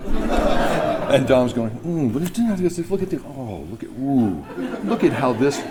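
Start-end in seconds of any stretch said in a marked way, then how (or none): 1.8-5.6 soft, slow, laughing admiration; mock connoisseur. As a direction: ham up the wine expert. Dom showing off wine to sommelier.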